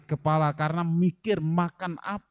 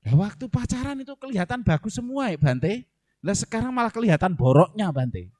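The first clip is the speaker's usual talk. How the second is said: complaining wife; 6 semitones higher, 4 dB louder than usual. Retold or imitated. Wife complaining to monk about husband.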